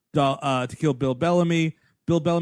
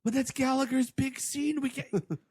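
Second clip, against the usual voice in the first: distraught plea; 10 semitones higher, 5 dB quieter than usual. Pretend. Playing distraught Gallagher.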